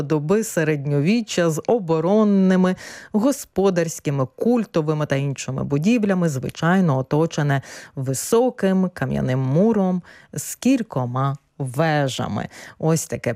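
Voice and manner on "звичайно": usual speaking voice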